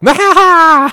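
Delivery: sudden high yelled nah of gloating; weird triumphant noise. Retold or imitated. Friend mimicking Matt's yell after killing him in Halo, mock gloating yelp. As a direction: blurt it out like a gamer who just scored a kill. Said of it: "he'd just make some weird noise".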